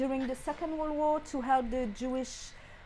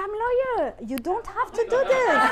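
loud, high, laughing through the words; laughing protest; 7 semitones higher, 9 dB louder than usual. Retold or imitated. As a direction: laugh as you push back at the editor. Laughing defiance at angry editor.

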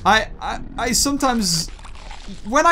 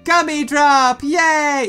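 mock cheer; 5 semitones higher, 4 dB louder, much slower than usual. Mocks advert with fake cheer.

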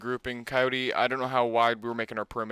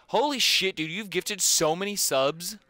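excited; 6 semitones higher than usual. Real, not acted. Thanking a sub gifter.

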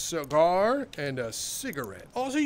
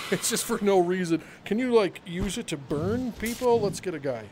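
ordinary talk, nothing marked

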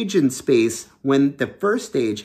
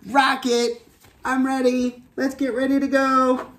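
high character voice, retake; 10 semitones higher than usual. Acted. Astronaut tries again calling her rocket, high and gentler. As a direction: call the rocket again in the same high voice, less pushed. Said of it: "You probably can't hear me very well like that".

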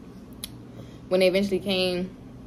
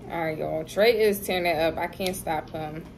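ordinary talk, nothing marked

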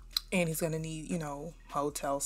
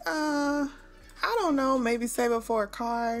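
put-on higher, hesitant kid voice; evasive grandson; 6 semitones higher, slower than usual. Prince dodging grandma's question, higher hesitant voice. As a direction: fumble for an answer like a kid hiding something. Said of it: "He's like".

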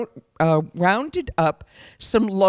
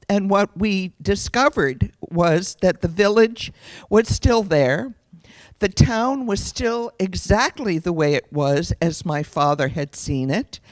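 ordinary delivery

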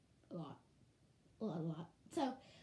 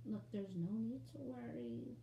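ordinary talk, nothing marked

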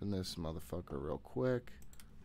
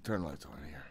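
ordinary talk

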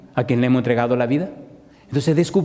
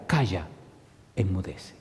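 much softer, lower, calm rebuke; hushed command; 6 semitones lower, 9 dB quieter than usual. Acted. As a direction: say it softly, like stilling the sea. Christ voiced calming the storm.

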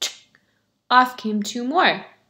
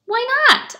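much higher, louder question; surprised why not; 14 semitones higher, 7 dB louder than usual. Acted. Beggar asking the shamas, surprised and loud. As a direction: shoot up in surprise.

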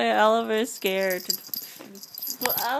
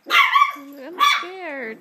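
much higher put-on dog voice; voicing scared dog; 8 semitones higher, 7 dB louder than usual. Mom voices Oliver afraid of Charlie, high put-on voice. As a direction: speak for the frightened puppy.

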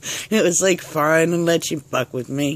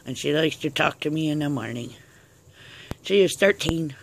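ordinary talk

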